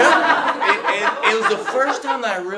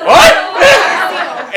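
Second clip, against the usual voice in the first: high, very loud yelp of disbelief; shocked shout; 13 semitones higher, 16 dB louder than usual. Retold or imitated. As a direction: blurt it out in comic shock. Retold shock at being asked.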